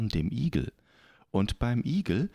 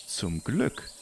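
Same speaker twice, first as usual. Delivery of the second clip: usual speaking voice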